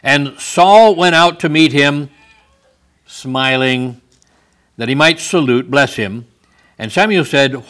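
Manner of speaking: loud, slow, ringing Bible reading; scripture reading. Acted. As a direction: proclaim the verse to the congregation. Reads scripture at full force.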